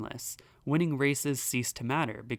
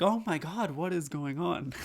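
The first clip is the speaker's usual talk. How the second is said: startled Pope; 4 semitones higher than usual. Retold or imitated. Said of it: "the Pope would be like".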